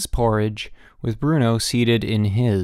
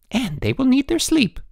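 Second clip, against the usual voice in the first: high-pitched, sharp wifely snap; snapping. A narrator's reading. Wife cuts in on headmaster about boys, snapping. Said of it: "snapped his wife".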